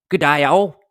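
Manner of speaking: hammed-up Australian g'day; amateur attempt at an Australian accent; Aussie accent. Shown as a demonstration. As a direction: put on a rough Aussie accent. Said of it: "That's my Aussie accent".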